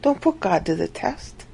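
ordinary talk

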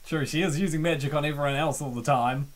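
usual speaking voice

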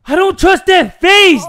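high, very loud panicked yell; startled shout. Real, not acted. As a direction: scream it in sudden shock. Jump scare from evil baby.

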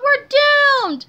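dramatic wail of doom; despairing cry. A narrator's reading. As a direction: wail it as if all is lost. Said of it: "cried Harold".

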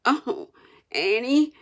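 high, pitiful groan; hammed-up sufferer; feigned moaning. A narrator's reading. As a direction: moan weakly to a worried aunt. Tom moans to his aunt.

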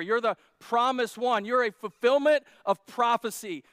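loud, building proclamation, slowing at the end; full-force preaching. Acted. Peter declaring Jesus Messiah, loud preaching. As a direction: preach it out with rising conviction.

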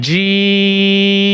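জিম with the ee held very long and slow; long drawn vowel. Shown as a demonstration. Teacher stretches জিম slowly for students. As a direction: stretch জিম over three alif lengths. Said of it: "এখানে তিন আলিফ টান হবে".

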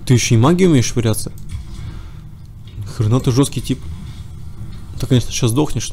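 ordinary delivery

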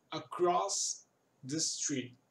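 very slow, word by word: "Across the street"; very slow demo. Shown as a demonstration. Teacher slowly models the phrase for students. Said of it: "Street é rua".

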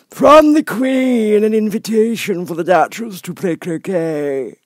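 high, slow, pompous footman voice; solemn footman. Acted. Frog footman solemnly repeating the invitation to the fish footman. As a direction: repeat it with stiff solemn ceremony. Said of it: "in the same solemn tone".

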